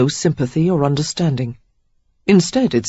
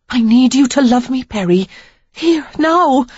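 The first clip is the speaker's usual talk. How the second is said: higher, louder urgent begging; Sarah urging her fiancé, higher and louder; urgent plea; 9 semitones higher, 5 dB louder than usual. A narrator's reading. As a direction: press him, breathless need. Sarah urging Perry in stable.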